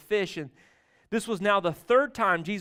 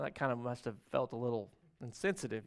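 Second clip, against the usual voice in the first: ordinary talk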